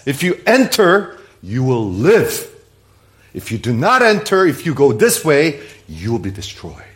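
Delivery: emphatic pronouncement, sinking at the verdict; absolute authority. Acted. Preacher quoting Jesus' warning, with absolute authority. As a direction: lay down the terms as an unbending judge. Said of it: "It's an absolute statement".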